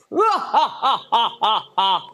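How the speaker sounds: hammed-up evil cackle, rolling on and on; cartoon villain laugh. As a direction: cackle like a cartoon baddie plotting. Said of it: "join me for a moment in laughing like a cartoon villain".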